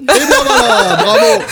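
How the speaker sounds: high, laughing cheer; excited. Real, not acted. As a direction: cheer it out, delighted. Annonce ravie après la blague. (ravi, en riant)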